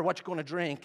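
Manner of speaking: usual speaking voice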